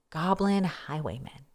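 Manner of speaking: usual speaking voice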